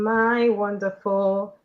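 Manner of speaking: usual speaking voice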